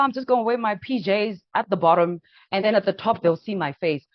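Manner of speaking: ordinary delivery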